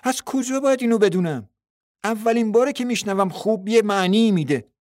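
surprised, loud. A narrator's reading. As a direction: burst out in surprise. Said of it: "جین که تعجب کرده بود با صدای بلند گفت".

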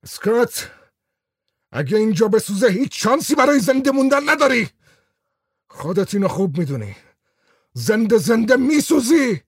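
high, loud urgent shout. A narrator's reading. Falk warning the man with the lighter, high urgent shout. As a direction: yell a warning that he'll burn. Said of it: "با صدای بلند گفت".

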